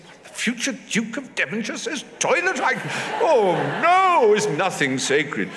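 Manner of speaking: high, loud, scandalised exclamation; be theatrically appalled, pitch shooting up; mock horror. Reacting to duke's heir saying toilet, in mock horror.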